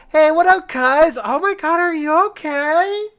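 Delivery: very high, loud squealed greeting; over-the-top high, loud concern; excitable classmate. Acted. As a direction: shout hello in a squeaky put-on voice. Classmate Up calls to friends.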